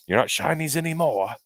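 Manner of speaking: bad New Zealand accent. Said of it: "It's a terrible New Zealand accent".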